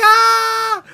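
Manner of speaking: excited shriek. Retold or imitated. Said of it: "And I'm like".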